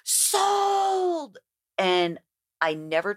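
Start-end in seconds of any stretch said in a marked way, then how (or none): 0.0-1.4 much higher mock shriek of Sold!. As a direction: shriek it like a trader in the pit. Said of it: "all of us are shrieking".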